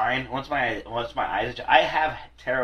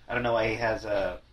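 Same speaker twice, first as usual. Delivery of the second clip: ordinary talk, nothing marked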